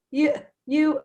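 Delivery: worked-up, acted-out sputter; retold hysterical outburst. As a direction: imitate yourself sputtering at your husband. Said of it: "been hysterical with my husband".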